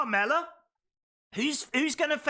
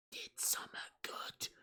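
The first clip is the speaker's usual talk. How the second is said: put-on breathy whisper, like soothing a toddler; mock whisper; 17 dB quieter than usual. Streamer whispering praise to Vince like a child, hushed.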